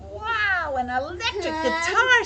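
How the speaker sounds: high, loud wow; amazed. Acted. Wilson at the window seeing a guitar, amazed. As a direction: exclaim with loud wonder.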